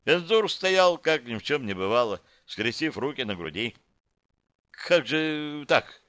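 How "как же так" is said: bewildered. A narrator's reading. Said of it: "Руру в растерянности".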